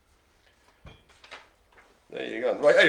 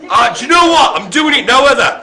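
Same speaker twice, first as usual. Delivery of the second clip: excited shout; 9 semitones higher, 10 dB louder, faster than usual. Real, not acted. Excited caller shouting at bingo.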